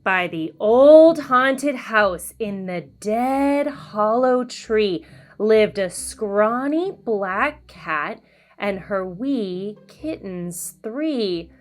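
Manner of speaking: ordinary delivery, nothing marked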